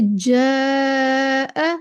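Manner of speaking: "जा" held very long with a thin, light vowel; slow, light, fronted alif in "जा"; बारीक अलिफ. Shown as a demonstration. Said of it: "इसको भी हम पढ़ेंगे". ("जा" का अलिफ बारीक, लंबा खींचकर)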